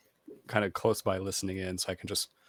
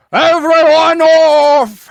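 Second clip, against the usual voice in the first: long, high bellow across the deck; shouted order; 18 dB louder, much slower than usual. Pretend. First mate bellowing to passengers, very loud shout. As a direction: call it out to the whole ship. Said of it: "Aye, aye, Captain".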